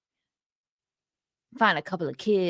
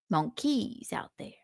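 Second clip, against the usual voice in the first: whispering; 8 dB quieter than usual. Retold or imitated. Whispered repeat of quote.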